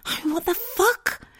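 high, slow, stunned what the fuck; outraged disbelief. Real, not acted. To listeners about the boss, stunned outrage. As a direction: squeak it out in disbelief.

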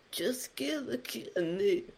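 breathy, slow whispered line. Pretend. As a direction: whisper it slowly like a secret. Quoting a song line.

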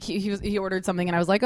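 ordinary delivery

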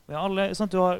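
usual speaking voice

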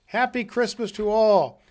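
high, loud greeting; joyful calling out. Acted. Scrooge greets the others, calling out joyfully. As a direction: proclaim it to everyone.

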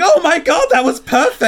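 very high, loud shriek of delight; excited scream. Retold or imitated. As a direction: scream it down the line, beside yourself with joy. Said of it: "I was screaming down the lines".

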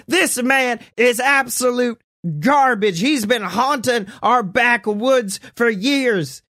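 high, slow frantic rant; ranting buster. Acted. Cryptid buster ranting at the crowd, high and slow. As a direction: denounce him like a street preacher. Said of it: "gets out of his car and is like".

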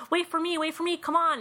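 put-on high, excited old lady calling; grandma calling for the others to wait, high and eager; Grandma Sandy. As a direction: hurry after them, calling out eagerly.

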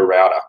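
router said as ROW-ta, rhyming with "outer"; Australian pronunciation. Shown as a demonstration. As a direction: say router with an "ow" vowel. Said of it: "Now, in Australia".

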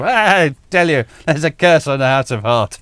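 booming pompous colonel voice; Bloodnock voice. Retold or imitated. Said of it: "I do have a fondness for Bloodnock".

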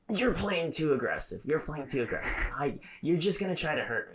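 high whiny complaint; imitating a whining little sister; mock whiny sister. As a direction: whine like a sore loser sibling. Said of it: "And she'd be like".

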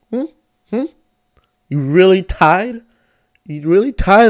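loud disbelieving question; can't believe they tied; incredulous. Real, not acted. Disbelief at tie.

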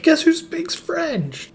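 very high, drawn-out mock boast; slow, pitched-way-up gloating; singsong bragging. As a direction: show off to your friends in a silly high voice. Acts out bragging to friends.

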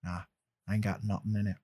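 playful Southern slang voice; joking Southern accent; code switching. Acted. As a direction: lean into the country talk. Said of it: "I'm code switching right now".

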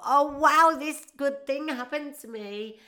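high, loud mock excitement; hammed-up delight; mock gushing. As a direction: gush over good news. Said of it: "You're gonna go".

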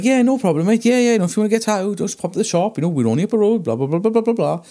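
mock cheerful obliging. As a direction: rattle off the friendly brush-off. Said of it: "I was like".

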